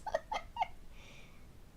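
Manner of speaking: squeaky breathless giggle; high airy giggle fit. Real, not acted. Laughing at bakkie slobber.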